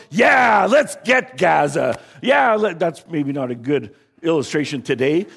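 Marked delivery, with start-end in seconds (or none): 0.1-2.8 loud, high cheering shout from the congregation; rally the crowd, fists in the air. Acted. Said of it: "they're going".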